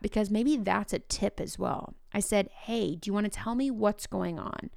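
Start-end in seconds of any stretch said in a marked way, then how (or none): 2.5-4.7 soft, calm and open, no pressure; gentle question. Shown as a demonstration. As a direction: ask it gently so the kid can open up. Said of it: "I want you to hear the way that I said that".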